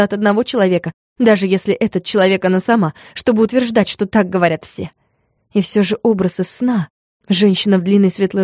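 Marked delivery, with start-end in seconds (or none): none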